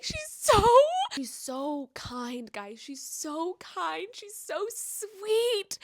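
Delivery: high, slow gushing adoration; slow adoring gush, squeal to start. Retold or imitated. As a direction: swoon over the heroine. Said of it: "I was just like".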